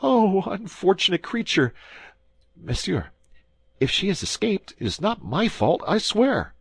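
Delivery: high, whining lament and oath; frantic high whine from a frightened husband; wailing prisoner. A narrator's reading. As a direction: wail and swear innocence in a high voice. Bonacieux lamenting and swearing.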